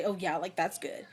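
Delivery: quiet, dismissive mock scoff; imitating a scoffing daughter, under the breath; imitated scoff. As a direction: toss it off low and scornful. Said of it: "she would scoff and say".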